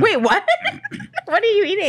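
laughing high and surprised. Real, not acted. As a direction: burst out laughing in disbelief.

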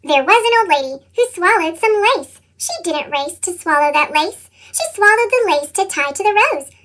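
usual speaking voice

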